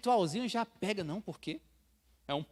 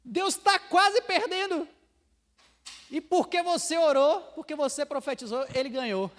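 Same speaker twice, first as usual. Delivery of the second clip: sarcastic mock; 9 semitones higher, 6 dB louder than usual. Mocking sarcasm about God losing.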